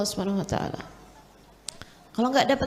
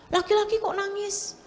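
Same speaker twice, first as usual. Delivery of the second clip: parental chiding; 7 semitones higher than usual. Retold or imitated. Parent scolding a crying son.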